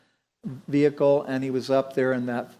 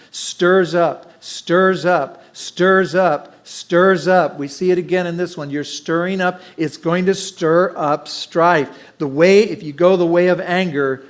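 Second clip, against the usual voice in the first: loud, emphatic sermon voice; preaching; 3 semitones higher, 7 dB louder than usual. Acted. Pastor urging his church to be peacemakers, full-force preaching. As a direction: drive the scripture home to the congregation.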